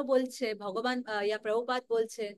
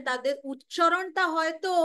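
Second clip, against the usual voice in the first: ordinary talk, nothing marked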